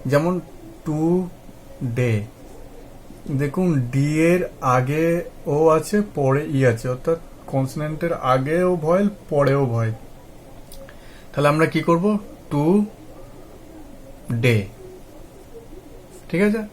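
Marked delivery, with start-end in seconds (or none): 0.9-2.1 long pause between টু and ডে; টু ... ডে as two detached syllables; broken into syllables. Shown as a demonstration. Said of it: "কনসোনেন্টের আগে দিয়ে আমরা". 12.5-14.6 very long pause between টু and ডে; detached, deliberate টু ... ডে; broken into syllables. Shown as a demonstration. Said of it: "এইভাবে ভেঙে ভেঙে উচ্চারণ করব".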